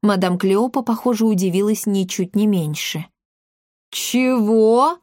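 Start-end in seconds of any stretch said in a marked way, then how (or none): 3.9-5.0 stunned, higher, rising question; narrated shock, pitch sliding up. A narrator's reading. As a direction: gasp out the question in disbelief. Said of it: "потрясённо выдохнула она".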